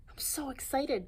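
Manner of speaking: breathless, excited whisper. Acted. Little ape whispering his excitement to Norman. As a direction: hiss it out with glee. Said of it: "Jim exclaimed".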